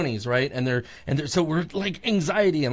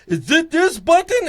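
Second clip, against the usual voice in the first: higher, louder, clueless; high mock question; 12 semitones higher, 6 dB louder than usual. Friend unsure which button, high clueless voice. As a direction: ask it squeaky and lost.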